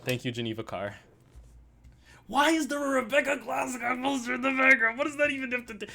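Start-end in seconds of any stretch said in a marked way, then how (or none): 2.3-5.9 high-pitched, baffled outburst; exasperated comic tirade; exasperated rant. Real, not acted. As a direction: let rip in a high, incredulous complaint. Exasperated rant about a baffling poster.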